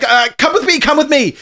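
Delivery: high, loud, frantic hurrying; urgent urging. Acted. Fleeing character urges dad along.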